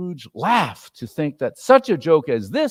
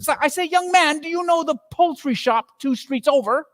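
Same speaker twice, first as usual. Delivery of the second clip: high, bright eager questioning; excited old man questioning the boy; eager old Scrooge; 13 semitones higher than usual. A narrator's reading. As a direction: ask quickly, full of glee.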